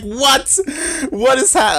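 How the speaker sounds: high, incredulous acted squawk; exaggerated high-pitched bewilderment; mock shocked reaction. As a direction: voice a chatter's disbelief, high-pitched. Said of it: "Patty Max in the chat is like".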